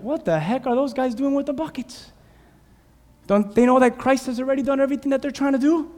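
high, scoffing outsider voice; mock onlookers. Preacher voicing worldly onlookers, mocking and high.

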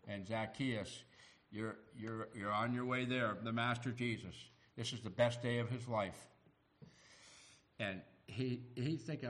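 ordinary talk, nothing marked

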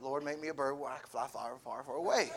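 quoting Jenny's prayer, half-joking; mock desperate prayer. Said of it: "praying like Jenny did in Forrest Gump".

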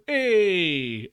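long, high, falling holler; loud drawn-out shout, imitating an audiobook's opening. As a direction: holler it high and let it slide down. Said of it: "where he just starts with".

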